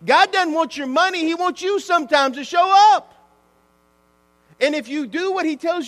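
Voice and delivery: loud, high preaching climax; shouting the point to the congregation; fiery preaching. Acted. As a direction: preach it out, full force. Preaching at full force.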